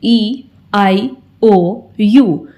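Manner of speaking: name the vowels one at a time, drawn out; slow vowel list. Shown as a demonstration. Teacher listing the five vowels for pupils, slowly. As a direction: draw out each vowel slowly. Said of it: "हमारे five vowels होते हैं".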